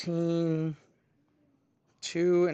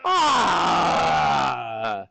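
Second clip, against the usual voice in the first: shouted mock monster roar; 4 dB louder than usual. Teacher telling the dream story, roaring as the monster. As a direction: bellow like a frustrated beast. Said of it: "He's like".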